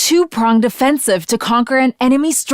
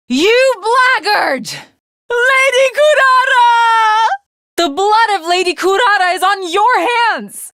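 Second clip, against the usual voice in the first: grieving furious outcry; 8 semitones higher than usual. Acted. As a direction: scream her name as if she just died. Comrade killed, cries out at foe.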